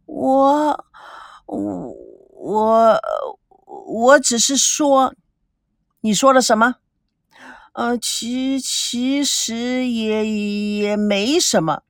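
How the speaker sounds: slow, halting, frightened stammer; nervous stammer. Acted. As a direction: stall fearfully, word by word. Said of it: "心里面也七上八下". (结结巴巴，心虚害怕)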